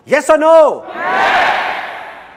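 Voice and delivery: high, loud call for an answer; rally the crowd. Real, not acted. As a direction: shout to audience. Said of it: "calling to audience".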